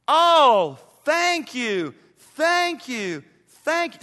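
high, loud cries of thanks; bursting with thankfulness; overwhelmed gratitude. Acted. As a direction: gush it out, each thank-you ringing.

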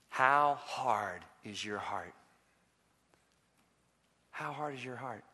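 low, soft and unhurried; solemn searching question. Real, not acted. Preacher searching listeners' hearts, low and solemn. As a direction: drop right down and let the question sink in.

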